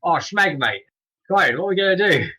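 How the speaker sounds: high, loud Oh, Schmeg, mate in alarm; mock panic, redone. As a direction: throw it out like a startled crewmate. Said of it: "except not with that accent".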